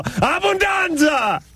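very high, drawn-out hammed-up cry; triumphant yell. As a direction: yell it like a mock victory call, stretched long. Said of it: "I pound my chest and I yell".

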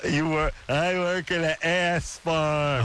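tongue held, words slurred, slow; holding tongue with fingers. Shown as a demonstration. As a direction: say it while pinching your tongue. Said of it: "hold your tongue and say".

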